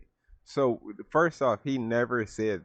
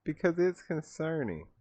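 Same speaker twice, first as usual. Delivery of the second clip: much higher mock-child voice; mocking the kid; 8 semitones higher than usual. Vlogger parroting the student's reply, high mocking voice. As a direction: squeak the kid's answer out high and mocking. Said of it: "what type of kid says that".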